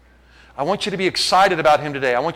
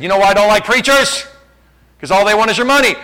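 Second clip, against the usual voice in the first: loud, raised mock grievance; imitating indignant complaint; 5 semitones higher, 5 dB louder than usual. Preacher imitates young man who distrusts preachers, indignant complaint. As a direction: complain loudly and high, as the accuser.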